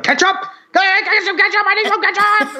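high, urgent mock cries for ketchup; hammed-up kitchen panic; frantic call. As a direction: play a worker panicking in the back. Frantic worker calling for ketchup.

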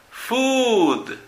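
"Food" with a long oo, high then falling; long vowel demo. Shown as a demonstration. Teacher models long sounds with "Food", long vowel demo. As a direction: stretch the oo in "Food". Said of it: "Los sonidos largos".

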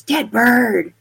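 ghostly voice imitation. Spirit answering the investigator on tape, lightly imitated voice. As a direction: imitate an eerie voice on tape, punched out. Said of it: "And this voice goes".

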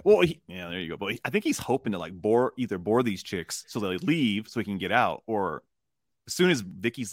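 ordinary talk

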